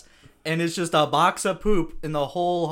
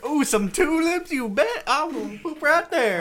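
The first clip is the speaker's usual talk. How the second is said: hammed-up homeless lady; 12 semitones higher, 4 dB louder than usual. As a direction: play a delighted lady finding tulips.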